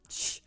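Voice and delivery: imitated sharp shush. Friend hushing me in public, imitated shush. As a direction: shush someone as if embarrassed.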